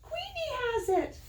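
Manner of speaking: very high, loud cry of alarm; little quail shrieking in panic. Acted. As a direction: yell for the stolen quilt. Quincy calls for help.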